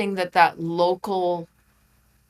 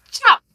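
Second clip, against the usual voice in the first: Chop, a sudden high, loud ninja shout; karate chop sound; 10 dB louder than usual. Retold or imitated. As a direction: bark out Chop like a karate strike. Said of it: "like, karate chop".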